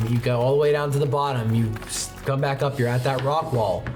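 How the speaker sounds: ordinary talk, nothing marked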